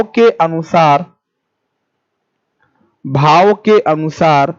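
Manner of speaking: slow, phrase repeated with pauses; slow dictation. Shown as a demonstration. Teacher dictating the next rule to students, slowly. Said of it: "लिखिएगा".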